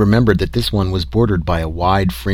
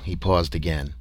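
usual speaking voice

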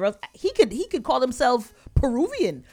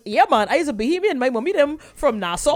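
higher, put-on island boasting; mock Bahamian claimant; 6 semitones higher, 4 dB louder than usual. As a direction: imitate someone suddenly claiming island roots. Mimicking sudden claimers of Bahamian roots.

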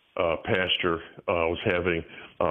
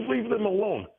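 much higher, mocking squeak; mock her, pitched way up; mimicked protester; 12 semitones higher than usual. Imitating the protester snapping at him again. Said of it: "She goes".

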